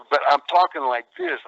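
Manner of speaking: ordinary talk, nothing marked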